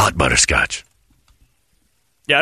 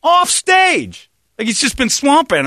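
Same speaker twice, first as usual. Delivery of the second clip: much higher and louder outburst; outraged exclamation; 5 dB louder, slower than usual. Real, not acted. Telling friends about the bass player, loud outrage. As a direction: shout it in disbelief.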